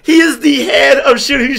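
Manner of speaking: high, loud, slow exasperated explanation; exasperated actors. Retold or imitated. Castmates explaining Nick Fury, high loud exasperation. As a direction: lay it out like she should know. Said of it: "they're like".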